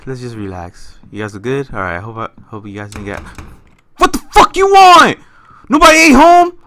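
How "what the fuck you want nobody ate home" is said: high, loud furious yell at the door; startled angry shout. Real, not acted. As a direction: yell at whoever is knocking. Yelling at knocking door in game.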